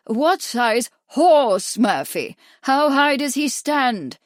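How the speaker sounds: very slow, loud, exasperated question; disgusted impatience. A narrator's reading. Doctor impatient with a foolish visitor, slow and loud. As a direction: talk down to him in disgust. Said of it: "a look of disgust".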